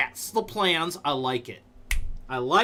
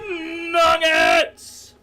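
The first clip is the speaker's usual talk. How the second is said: loud, high call across the room; shouted name; 13 semitones higher, 8 dB louder than usual. Real, not acted. Streamer calling out to Nuggets with a happy loud shout. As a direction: holler the name out with delight.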